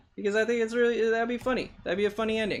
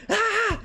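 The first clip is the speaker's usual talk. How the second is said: mock scream. Artist acting out Reed screaming at the monster, high mock scream. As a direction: yelp like a startled kid. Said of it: "Reed is screaming".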